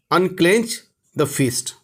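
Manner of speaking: very slow and deliberate; slow model phrase. Shown as a demonstration. Teacher slowly models English for Hindi learners. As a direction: lay each word out for the class. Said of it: "teacher models English phrase".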